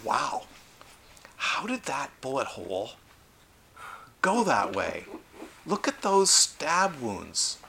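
an excited forensic pathologist studying a body, marvelling. Retold or imitated. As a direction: marvel over the evidence. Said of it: "a good excited forensic pathologist".

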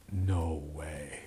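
low, soft dread; hushed refusal. Acted. Scared student answering the sailing invitation, hushed and low.

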